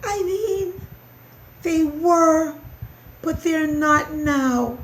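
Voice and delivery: high, squeaky mouse voice; hesitant little squeak; anxious mouse. A narrator's reading. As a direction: explain it in a small high voice.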